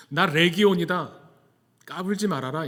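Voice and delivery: forceful mock demon boast; demon's challenge.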